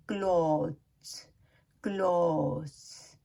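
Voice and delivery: क्लॉथ्स drawn out, the th melted away; native pronunciation. Shown as a demonstration. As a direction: say क्लॉथ्स slowly like "kloze". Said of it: "native English जो बोलने वाले हैं".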